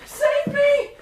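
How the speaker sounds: high, frantic cry for help; desperate plea. Acted. Daughter begs her father for rescue, frantic and high. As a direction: beg him to save you.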